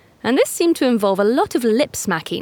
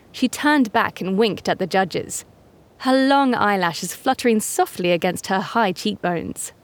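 ordinary delivery

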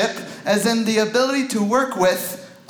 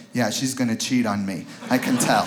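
knowing low mutter; 7 semitones lower than usual. Retold or imitated. Imitated inner thought at bowling.

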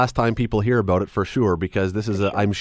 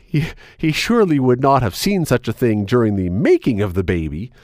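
normal talking voice

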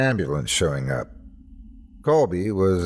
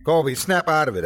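urgent, raised-pitch command; yelling; 6 semitones higher than usual. A narrator's reading. Officer trying to reach a crazed attacker, yelling. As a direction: yell to get through to him. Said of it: "I yelled".

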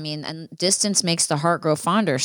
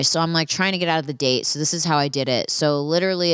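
ordinary talk, nothing marked

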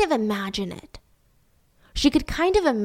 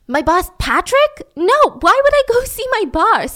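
higher, louder incredulous denial; indignant protest; 10 semitones higher, 5 dB louder than usual. Retold or imitated. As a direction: act out a baffled suspect pushing back. Acting suspect protesting under questioning.

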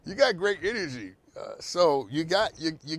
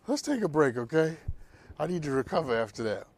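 usual speaking voice

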